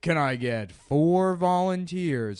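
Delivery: loud, slow stage announcer call; showman call. Retold or imitated. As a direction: boom it out to the crowd, slowly. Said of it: "I'm like".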